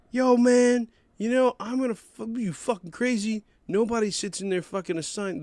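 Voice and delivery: high-pitched ranting mock outrage; imitating irate moviegoer. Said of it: "this is how he's going".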